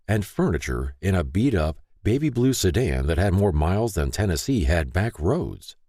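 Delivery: ordinary delivery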